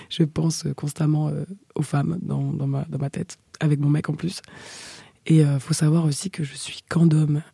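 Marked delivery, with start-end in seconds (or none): none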